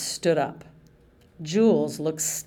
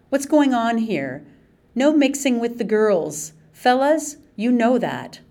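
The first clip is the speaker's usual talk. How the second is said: scolding; 6 semitones higher, 5 dB louder than usual. Acted. Staff member scolds the kids.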